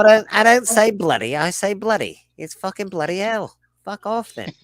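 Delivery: hammed-up British swearing, indignant; British accent. Friend ranting in a British accent at mates. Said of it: "you're pretty good at your accents".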